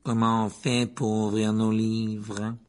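icy, slow drawl; Drago Malfoy, glacial et traînant. A narrator's reading. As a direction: stretch the words out with cold disdain. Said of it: "la voix glaciale et traînante". (voix glaciale et traînante)

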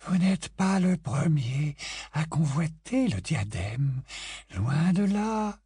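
slow, high, disdainful drawl. A narrator's reading. Grey Lady scorning Harry's request, slow and disdainful. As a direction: look down on him. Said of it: "lança-t-elle avec dédain".

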